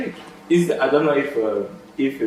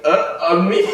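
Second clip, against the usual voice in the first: laughing mock shock; 7 semitones higher, 7 dB louder than usual. Imagining the spy's shocked reaction.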